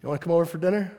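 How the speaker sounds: friendly invite. Acted. Jesus inviting sinners to eat.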